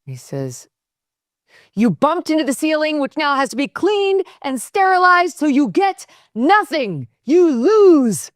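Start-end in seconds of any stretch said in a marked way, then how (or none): 1.7-8.3 high, furious Wonka rant; shrill scolding Wonka voice; Wonka impression. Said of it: "How are you at imitating, uh, the Wonka".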